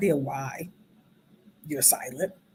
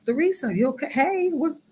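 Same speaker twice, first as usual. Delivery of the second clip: concerned friend; 9 semitones higher than usual. Retold or imitated. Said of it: "trying to still grab".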